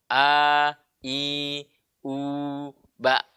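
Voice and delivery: A, I, U sustained and unpressed, BA cut short; clean, even drilled vowels; freely released hamzah. Shown as a demonstration. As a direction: let each vowel out freely, then stop "ba" in the throat. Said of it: "lepas suaranya plong keluar".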